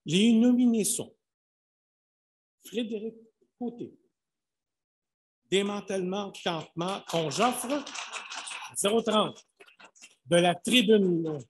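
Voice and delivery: loud, high announcer calling names; booming prize announcer. Acted. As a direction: call out each nominee to the hall. Announcing the nominees.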